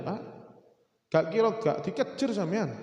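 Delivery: ordinary delivery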